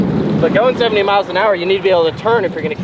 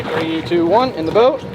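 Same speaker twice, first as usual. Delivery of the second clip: ordinary talk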